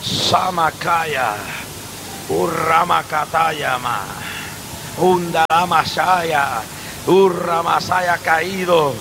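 slow soft tongues; quiet tongues. Real, not acted. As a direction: murmur tongues slowly and softly. Glosolalia tras el clímax. (lenguas susurradas, lentas y suaves)

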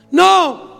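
sudden high cry of no; a child's scream jolting the room awake; retold scream. A son screaming no from his hospital bed. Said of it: "se escucha un grito".

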